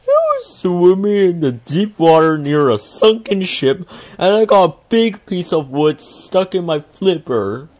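a big whale complaining of its hurt flipper. A narrator's reading. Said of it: "the whale cried".

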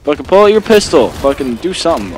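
loud, high yell at the screen; frustrated yell. Real, not acted. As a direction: shout at a useless teammate. Yelling at teammate on screen.

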